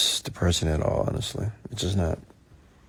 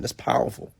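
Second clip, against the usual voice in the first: normal talking voice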